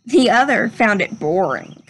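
gentle, measured storybook read-aloud; unhurried classroom story reading voice; reading to children. Acted. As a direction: read it like a picture book to a class. Teacher reads picture book aloud.

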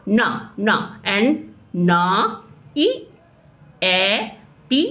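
"ना, ना" then "ईएटी" stretched very slowly; phonics sounding out. Shown as a demonstration. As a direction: sound out each letter slowly for a child. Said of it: "एन का sound होता है ना". (बच्चों को ध्वनि धीरे-धीरे सिखाते हुए)

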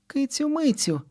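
high calling shout. A narrator's reading. Mother calls her runaway daughter, high call. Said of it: "гукає мама Киці Миці".

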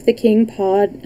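plain reading voice

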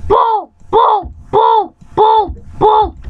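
high shouted boom, boom; high boom shouts. Pretend. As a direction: yell boom like gunfire. Shooting in game.

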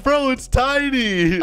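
high, slow whiny protest; exaggerated high-pitched grumbling; mock complaint. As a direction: imitate an indignant player, stretching it out. Acting out player's complaint.

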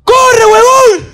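high, drawn-out shout; shouted advice. Retold or imitated. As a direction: yell at the boy to run. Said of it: "el mejor consejo".

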